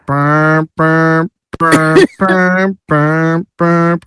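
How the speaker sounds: burping tuba imitation; mock burping band. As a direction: blat it out like an off-key tuba. Mimics band's sour playing.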